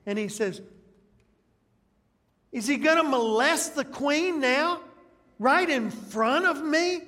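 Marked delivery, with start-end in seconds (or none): 2.5-7.0 louder, higher indignant outburst; king catching Haman beside the queen; angry king. Acted. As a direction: snap at him like an outraged king. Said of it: "King's already ticked".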